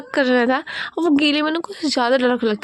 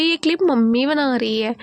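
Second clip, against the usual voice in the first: normal talking voice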